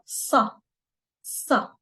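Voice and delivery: "sa" with a heavy, dark Arabic s; emphatic sa. Shown as a demonstration. Teacher introduces an Arabic sound to learners, emphatic sa. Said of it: "it's an emphatic sound".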